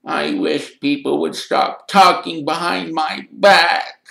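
tearful, high, drawn-out complaint; choked with tears. A narrator's reading. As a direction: whine it slowly as if near tears. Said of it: "in a voice choked with tears".

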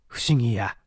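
ordinary delivery

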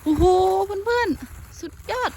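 high, loud and drawn-out cry of delight; real thrill, voice jumping up high and loud; excited. Real, not acted. Vlogger thrilled to find many pumpkins, loud excited cry. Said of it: "ตื่นเต้น ตื่นเต้น ขออภัยค่ะ เสียงดังเลย".

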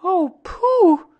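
put-on very high, loud child's wail; distressed cry. A narrator's reading. Christopher Robin cries out for missing Pooh, high distressed cry. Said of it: "cried Christopher Robin".

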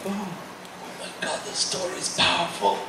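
soft, stunned private thought; hushed awe. Retold or imitated. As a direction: think it aloud under your breath, awestruck. His awed thought seeing tears.

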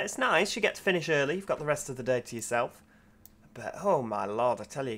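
usual speaking voice

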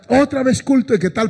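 high-pitched grumble of a reluctant churchgoer; mock complaint. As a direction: act out being fed up, starting high. Voices the indifferent churchgoer complaining.

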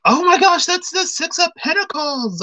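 very high-pitched squealed self-quote; mock excitement. Retelling own reaction to spotting the card, mock excitement.

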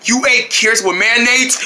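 ordinary delivery

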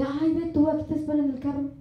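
rushed, fast, offhand excuse; hurried excuse. Retold or imitated. A wife hurrying her husband off so she can play, fast. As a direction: brush them off quickly to get back to the game.

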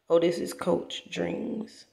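usual speaking voice

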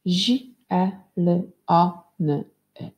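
Zi, e, l, o, n, y as separate sounds. Shown as a demonstration. Teacher recounts the sounds for pupils, one sound at a time. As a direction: sound out zielony with short, even gaps. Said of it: "Ile mamy głosek?".

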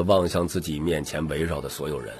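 ordinary delivery

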